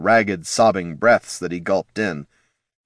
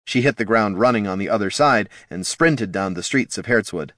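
ordinary delivery, nothing marked

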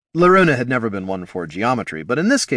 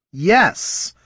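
sharp, higher irritated yes; 8 semitones higher than usual. Acted. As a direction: snap it back, a bit annoyed. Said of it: "Jin sounded a little irritated".

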